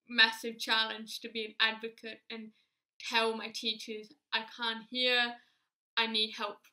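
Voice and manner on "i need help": ordinary delivery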